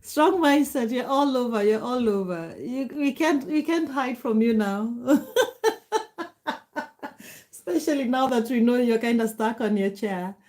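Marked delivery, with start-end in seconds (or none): 5.0-7.1 long, high, pulsing laughter; teasing laugh. Real, not acted. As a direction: laugh heartily at your own teasing. Teasing a viewer stuck on chair.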